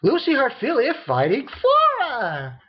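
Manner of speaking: high, excited match announcement; announcer call. Retold or imitated.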